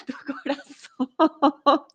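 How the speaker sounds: reading that dissolves into giggles; real laughter overtaking the line; breaking into laughter. Real, not acted. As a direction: start reading straight, crack up on the last words. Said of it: "Me da mucha risa".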